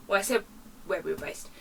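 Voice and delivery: usual speaking voice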